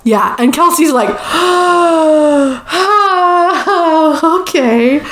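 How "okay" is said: long high gasp into a weak okay; hammed-up stunned reaction; mock shock. Retold stunned reaction to singing news.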